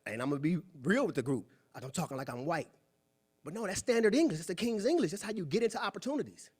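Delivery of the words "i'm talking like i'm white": insecure fear. Retold or imitated. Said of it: "you have fear".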